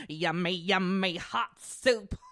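slow, relishing demon voice; love demon. Retold or imitated. Love demon to couples in a haunted house, slow put-on creepy voice. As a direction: savour it like a playful soul-eater. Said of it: "and I'd be like".

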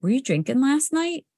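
suspicious mom. Retold or imitated. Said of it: "she's like".

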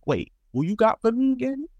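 high-pitched puzzled ask; retold confused double-check; puzzled question. Asking coworker again after not hearing him, puzzled.

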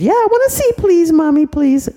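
very high, loud pleading; begging little girl. Acted. Little girl begging Mommy to see the picture. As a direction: beg like an eager toddler.

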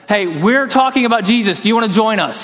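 calling out to drunk guys in a truck, a lively retold yell. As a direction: shout it out to a truck in traffic. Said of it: "I just yelled out at them".

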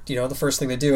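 ordinary delivery

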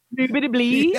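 badly faked French gibberish; Joey. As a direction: attempt French and miss completely. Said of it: "he tries to speak French".

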